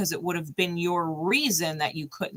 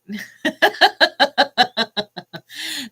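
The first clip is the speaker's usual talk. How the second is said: long laughter; 7 semitones higher, 6 dB louder than usual. Real, not acted. Laughing at the card.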